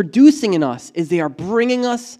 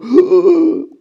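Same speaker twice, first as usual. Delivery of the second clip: sharp, high, loud gasp of shock; alarmed gasp; 11 semitones higher, 7 dB louder than usual. Retold or imitated. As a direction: gasp as if caught out. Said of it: "And you go".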